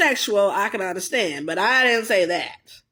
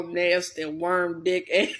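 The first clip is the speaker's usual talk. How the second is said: usual speaking voice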